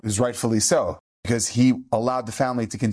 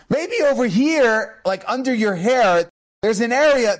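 much higher, louder and very slow mock astonishment; hammed-up discovery; 8 semitones higher, 4 dB louder, slower than usual. Rabbi points under the boy's hair: hammed-up, high, drawn-out surprise. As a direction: play the big reveal for the child.

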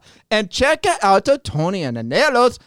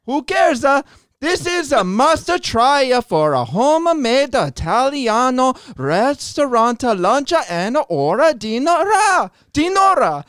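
put-on Italian accent; 3 semitones higher than usual. Guest reading the sponsor ad in a put-on Italian accent. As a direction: ham up an Italian ad read. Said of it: "Read it in your Italian accent".

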